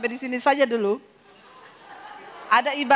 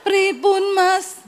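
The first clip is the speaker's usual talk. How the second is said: higher, louder honeyed voice; sweet Javanese wife; 6 semitones higher, 4 dB louder, much slower than usual. Pretend. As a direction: play the refined Solo wife. Refined wife role.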